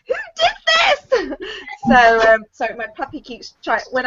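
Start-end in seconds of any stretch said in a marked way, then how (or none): 0.1-1.4 very high, drawn-out mock wail; hammed-up high shock; horrified mock screech. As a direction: wail it slowly in pretend horror. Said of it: "And I'm like".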